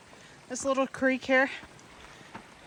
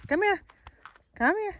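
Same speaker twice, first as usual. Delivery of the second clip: high dog call; 7 semitones higher than usual. Real, not acted.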